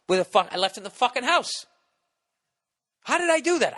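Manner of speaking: high, loud disbelief at himself; exasperated at your own forgetfulness; loud high-pitched "how did I do that"; frustrated outburst. Retold or imitated.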